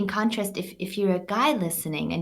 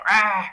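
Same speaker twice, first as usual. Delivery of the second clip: short half-hearted "rah" roar, at usual pitch; feeble lion roar; 6 dB louder than usual. Retold or imitated. Man's weak first attempt on stage, half-hearted lion roar. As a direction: imitate a timid man's first try at roaring. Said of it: "had him roar like a lion".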